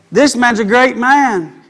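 usual speaking voice

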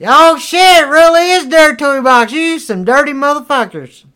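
very high, loud exclamation; hollered mock shock. Real, not acted. Reacting to the dirty toy box, hollered mock shock. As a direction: cry out in gleeful disbelief.

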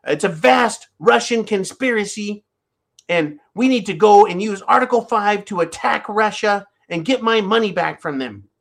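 high put-on politician voice; lightly hammed-up Hillary; Hillary Clinton impression. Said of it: "Hillary Clinton's voice".

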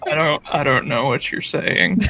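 low put-on southern accent; mock southern voice, pitched well down. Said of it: "he didn't have a southern accent".

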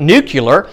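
nuclear pronounced NOO-kyuh-ler; nuclear mispronounced as nucular. Shown as a demonstration. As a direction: say nuclear with kyu in the middle. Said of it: "there's no word nuclear".

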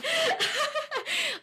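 high, embarrassed burst of laughter. Real, not acted. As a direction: laugh at yourself, caught out. Laughs at her own gaffe.

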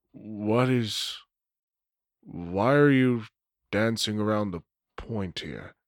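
low, soft, conspiratorial vampire; secretive Nosferatu dealer. Acted. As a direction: talk him down like a shady dealer. GM voices Lane again.

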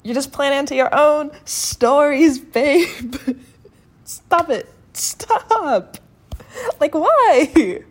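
loud, high-pitched pleading exasperation; worked-up, half-laughing outburst; exasperated outburst. Real, not acted. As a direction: burst out, begging them to stop. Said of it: "I just had this little outburst".

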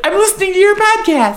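very high, light mock text voice; high-pitched listener voice. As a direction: pipe up like an eager fan. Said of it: "be like".

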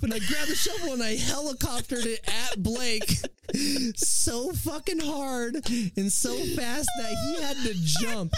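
high-pitched, laughing, near-shouting storytelling; riding the thrill of the memory; excited shouting. Real, not acted. Telling friends the shovel story, excited high shout.

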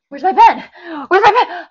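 high loud searching whine; mock whiny shout. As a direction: call out for the pen, whining. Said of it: "He'll be like".

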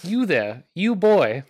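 loud, high imperious Southern put-on; mock Southern summons. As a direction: call out like a haughty Southerner. Mock Southern coworker summons IT helper.

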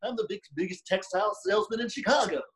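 high-pitched mock blustering caller; movie character impression. Ferris Bueller's friend bluffing the principal, high put-on voice. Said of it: "that's, like, what he does".

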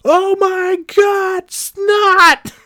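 mocking the old monitor's horror, extreme high shriek; hammed-up shriek. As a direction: go over the top, as shrill as you can. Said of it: "that's what they fucking said".

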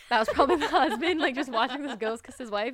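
laughing out loud at the husband joke. Real, not acted. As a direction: crack up while telling it.

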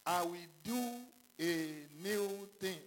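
slow, emphatic voice of God's promise; preaching. Acted. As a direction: declare it slowly like God speaking.

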